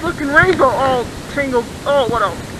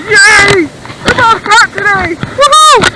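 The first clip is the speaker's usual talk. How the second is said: raised, stretched cheer ending in a high whoop; real jubilant delight; celebrating; 4 semitones higher, 5 dB louder, much slower than usual. Real, not acted. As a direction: build up to a loud woohoo. Celebrates both catches.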